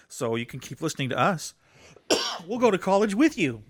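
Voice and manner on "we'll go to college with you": playful. Acted. Playful aside to listeners.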